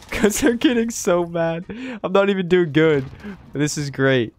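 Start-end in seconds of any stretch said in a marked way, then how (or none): 0.1-3.0 laughing. Real, not acted. Laughing at raging opponents.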